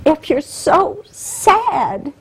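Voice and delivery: high, teary voice on the edge of crying; slow, fighting back real tears; tearful. Real, not acted. Guest explaining her emotions, tearfully. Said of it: "that's why I cry all the time".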